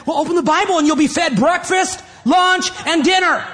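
high, loud scolding sermon shout; preaching at full force. Real, not acted. Preacher telling grumbling churchgoers off, fired-up shout. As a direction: rebuke the complainers, pitched high and punched out.

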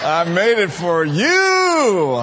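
drawn-out laughing southern drawl; hammed southern twang. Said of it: "with that little cute little country southern twang".

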